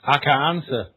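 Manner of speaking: usual speaking voice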